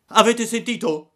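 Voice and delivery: high, loud indignation; outraged shout. Acted. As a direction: rouse the gang over an insult. Insulted boy rouses the gang. (grido indignato)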